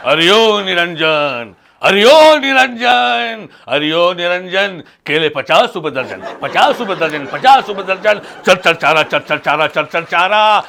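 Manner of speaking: sing-song vendor's cry; street hawker. Acted. Mock holy man chants like a banana seller and scrap buyer, hawker's cry. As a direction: chant it like a peddler selling bananas and buying scrap. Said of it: "tin डब्बे जमा करने वाले या फिर केले बेचने वाले".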